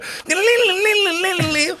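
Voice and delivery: high trilled "lilli-lilli" banjo imitation; imitated banjo noise. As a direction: mimic frantic banjo picking in a high voice. Mimics playing the banjo.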